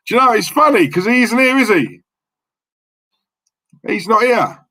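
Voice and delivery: high, teasing mock surprise; high sing-song mock innocence. Teasing that Terry is absent.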